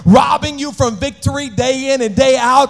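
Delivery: shouted, rising sermon cadence; full-force preaching. Real, not acted. Preacher shouting over the music at the crowd. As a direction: preach it hard over the organ.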